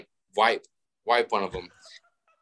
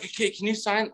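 much higher mock fan voice; imitating fans; 9 semitones higher, 3 dB quieter than usual. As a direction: ask like a pushy autograph hunter. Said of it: "they're like".

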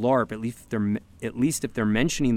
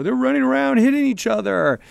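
high-pitched mock alarm, much higher than usual; freaked-out neighbor; 12 semitones higher, 5 dB louder than usual. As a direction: imitate panicked neighbors raising the alarm.

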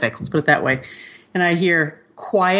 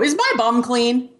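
put-on child's voice; 5 semitones higher, slower than usual. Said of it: "look at me and go".

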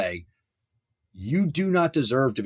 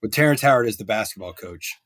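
ordinary delivery

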